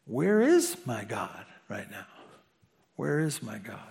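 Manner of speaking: low, quiet, lost question; despairing murmur. Retold or imitated. Lonely crying believer asking where God is, quietly despairing. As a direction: ask it as if alone and crying.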